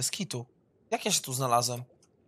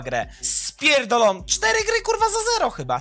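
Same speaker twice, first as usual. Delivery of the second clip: ordinary talk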